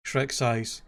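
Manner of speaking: ordinary delivery, nothing marked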